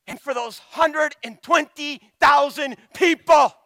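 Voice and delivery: high, loud burst; sudden shout. Acted. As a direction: swell up on the people. God's question peaks.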